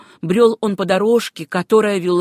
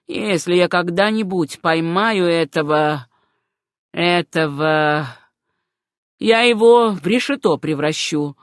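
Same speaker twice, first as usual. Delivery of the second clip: savage threat; 4 dB louder than usual. A narrator's reading. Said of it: "необузданная жестокость".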